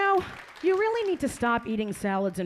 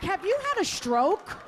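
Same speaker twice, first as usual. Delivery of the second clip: incredulous; 9 semitones higher than usual. Acted. Stunned reply to weird talker.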